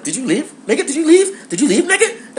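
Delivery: high-pitched, squeaky mock disbelief; incredulous outcry. Calling out the roommate who left overnight, in high-pitched disbelief. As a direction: voice leaping way up, question after question. Retold shocked texts to vanished roommate.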